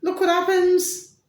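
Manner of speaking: high, loud exclamation; exclaimed. Shown as a demonstration. Telling viewers about chaos, high exclaiming. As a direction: sell the shock up high. Repeated for effect.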